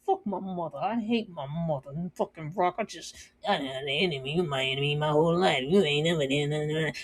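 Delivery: lightly voiced street accent, low and soft; mock the kid's resentful rant; imitating Kanan's accent. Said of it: "and his accent".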